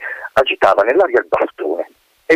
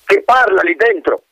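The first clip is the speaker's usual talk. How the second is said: colonel calling out; 10 semitones higher than usual. Acted. Colonel calls into the rock, demanding. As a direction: rap the stone and call inside.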